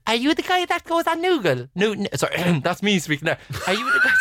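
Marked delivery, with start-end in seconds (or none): 0.1-1.7 put-on higher English girl's voice; English accent. Fan asks if he's the Noogle guy, English accent. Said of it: "she was English". 3.7-4.1 put-on a squeaky, much higher English girl; English accent. Mimicking the English fan, squeaky English accent. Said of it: "she was English".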